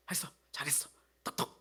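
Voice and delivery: soft, unvoiced encouraging whisper. Shown as a demonstration. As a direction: whisper praise to a coworker. Encouraging a coworker with a pat.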